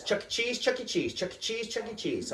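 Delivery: put-on high, relentless kid chant; excited little daughter. Daughter begs dad all day, excited child voice. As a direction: imitate a pestering child in a high voice. Daughter pesters again at noon.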